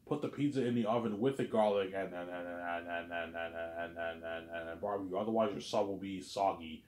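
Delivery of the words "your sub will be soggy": usual speaking voice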